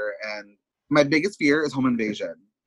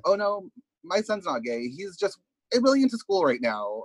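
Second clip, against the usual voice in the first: denial parent voice; 6 semitones higher than usual. Retold or imitated. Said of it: "it's that denial of".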